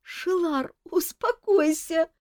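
high, soothing, with a giggle; calm the king while amused; Mafey soothing the king with a giggle; sympathetic chuckle. Acted. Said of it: "сочувственно хихикнул Мафей".

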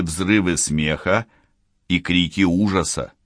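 ordinary delivery, nothing marked

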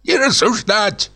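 put-on high, thin old man's command; hoarse old man's voice. A narrator's reading. Old director silences narrator, hoarse and high. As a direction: rasp the order like an old boss. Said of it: "старческий, охрипший голос".

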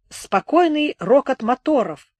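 ordinary delivery

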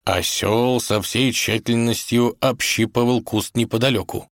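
ordinary delivery, nothing marked

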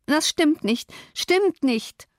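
high, moaned protest; moaning. A narrator's reading. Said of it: "stöhnte sie".

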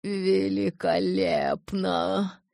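satisfied elder. Acted. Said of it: "удовлетворённо вздохнул".

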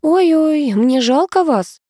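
high, slow, hammed-up sympathy; theatrical pity. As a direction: ham up feeling sorry for her. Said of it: "Продавец картинно схватился за голову".

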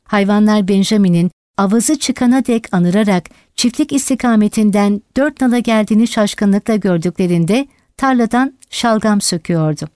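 plain reading voice

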